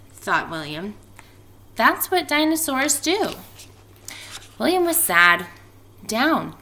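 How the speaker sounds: lively storybook reading voice; expressive read-aloud for a class; reading to children. A narrator's reading. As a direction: read it out like story time for kids. Teacher reads picture book aloud.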